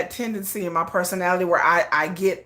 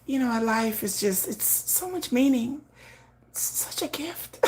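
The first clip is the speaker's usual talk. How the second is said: much softer, slow, airy and serene; serene hushed musing; 10 dB quieter than usual. Retold or imitated. Softly serene talk to viewers about life as a gift. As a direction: float it out like a blissed-out soul. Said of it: "my whole demeanor would be like".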